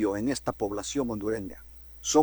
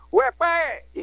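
mock yell pitched much higher; high street shout; 12 semitones higher, 3 dB louder than usual. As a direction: shriek the insult across the avenue. (grito agudo imitado en la calle)

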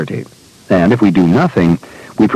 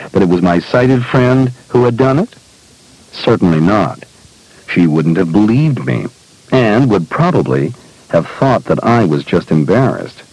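ordinary delivery, nothing marked

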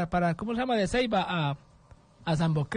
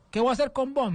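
higher-pitched retold exasperation; mock-annoyed, pitched up; exasperated Spaniard, imitated; 6 semitones higher than usual. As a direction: act out an official losing patience. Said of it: "bien enojado".

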